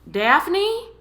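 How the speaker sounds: high, loud questioning call. A narrator's reading. Tom looks for Daphne after the fall, calling loudly. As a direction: call out to find someone in the dark. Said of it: "she heard Tom call loudly".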